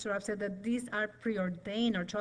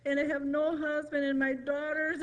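tearful complaining; 5 semitones higher than usual. Retold or imitated. Woman retelling her New Year's complaint to God, mock-tearful whining. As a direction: whine a complaint to God, imitating your own crying. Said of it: "start crying and complaining and saying".

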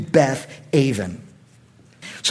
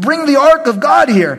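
Saul's command; 8 semitones higher, 5 dB louder than usual. Acted. Saul commands the priest.

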